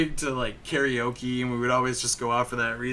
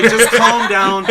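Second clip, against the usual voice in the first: loud, high and drawn out; mock shouted plea, stretched slow; retold heated outburst; 9 semitones higher, 13 dB louder, slower than usual. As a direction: call it out over laughter.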